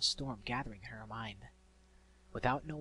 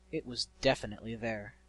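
ordinary delivery, nothing marked

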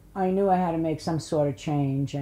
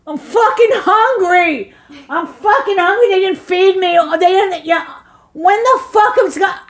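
loud, high, furious yelling; cursing shout; 14 semitones higher, 11 dB louder than usual. Retold or imitated. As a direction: bellow the curses as if starving. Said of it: "I used to curse so much".

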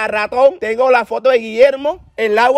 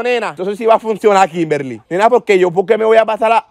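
ordinary talk

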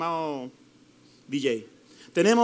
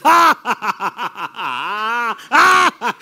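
mocking laugh at the devil; 4 dB louder, much slower than usual. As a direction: laugh in the devil's face as a show. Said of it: "usted le dice". (risa burlona y fuerte contra el diablo)

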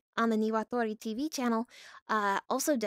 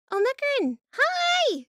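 high, loud squealed hello; excited squeal climbing higher on the hello; hyper greeting; 13 semitones higher, 6 dB louder than usual. Real, not acted. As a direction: greet a newcomer with hyper delight. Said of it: "Yes, I am very hyper".

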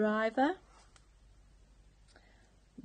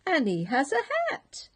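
high, fast indignant cry; a mock-scandalised lookout; outraged alarm; 8 semitones higher, 4 dB louder, faster than usual. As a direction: blurt it in disbelief. First mate continues alarm.

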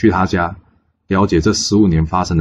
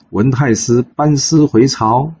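announcing; 6 semitones higher, slower than usual. A narrator's reading. Herald announces the return.

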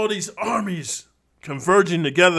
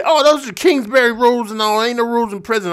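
higher, whiny scoffing voice; pitched-up mock rebuttal; mocking critic; 7 semitones higher, 3 dB louder than usual. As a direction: mimic the know-it-all objector. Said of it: "I know what you're gonna say".

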